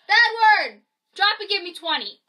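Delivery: playful barked order, loud and high; mock drill sergeant. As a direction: bark it like a drill sergeant, in jest. Orders push-ups after winning game.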